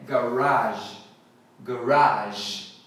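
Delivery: garage said ga-RAZH, stress on ra; main garage pronunciation. Shown as a demonstration. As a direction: stress the second syllable of garage. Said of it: "a pronúncia mais falada". (garage com tônica no ra, garáj)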